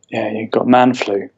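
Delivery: normal talking voice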